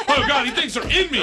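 high-pitched, half-laughing mock panic; retold panic. Comic retelling his bear fright to hosts, mock panic. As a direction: act out alarm as a funny story.

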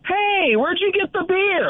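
high-pitched mock yell across the stands; retold loud call. FSU fan calling to the crowd about beer, high mock yell. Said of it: "Like, really, really loud".

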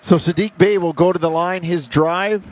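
brisk live game call; play-by-play. Acted. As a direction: call the action as it happens.

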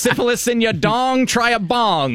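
high, rhyming huckster pitch; snake oil seller. Retold or imitated. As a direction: sell the cure like a barker. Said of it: "[name]".

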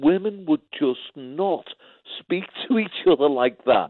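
high-pitched imitation of a disapproving wife; mock-indignant, high voice; appalled wife. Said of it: "She said".

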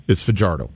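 Fajardo said fa-JAR-do; English J in Fajardo; Fajardo with a J sound. Shown as a demonstration. Co-host giving the player's own ruling, Fajardo with a J sound. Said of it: "I asked him".

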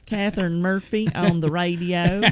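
a light Arkansas twang with vowels drawn out; a lightly done, drawn-out Arkansas twang. Shown as a demonstration. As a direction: say your radio sign-on with a slow Arkansas drawl. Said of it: "Arkansas is the slow twang, where you draw things out".

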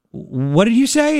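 high, drawn-out retold question; mock disbelief, called to wife; startled call-back.

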